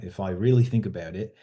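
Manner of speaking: put-on Australian accent. Shown as a demonstration. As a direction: do an Australian accent, thinking it through. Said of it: "doing an Australian accent".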